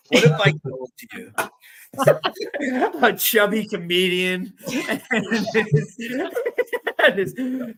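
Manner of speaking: half-laughing dramatic documentary tease; documentary narrator. Pretend. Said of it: "I can't wait for the thirty, thirty for thirty".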